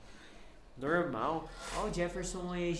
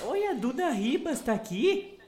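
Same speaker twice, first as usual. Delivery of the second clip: higher, louder, fast gushing hello; excited greeting; 3 semitones higher, 3 dB louder than usual. Real, not acted. Host greets a famous viewer in the chat, gushing excitedly. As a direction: gush over a famous guest.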